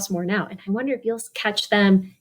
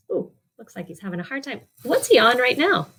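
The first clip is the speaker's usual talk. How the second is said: ordinary delivery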